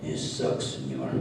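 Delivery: low Mexican puppet voice; deep talking burrito. Acted.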